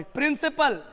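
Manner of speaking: normal talking voice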